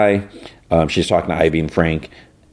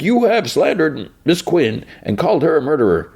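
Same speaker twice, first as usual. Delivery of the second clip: pompous editor; 9 semitones higher than usual. Retold or imitated. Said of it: "He's like".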